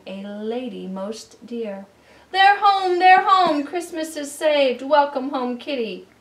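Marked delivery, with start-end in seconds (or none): 2.3-6.1 very high and loud, shouting with joy; overjoyed cry. Acted. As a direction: cry out in delight at the reunion. Joyful reunion cry.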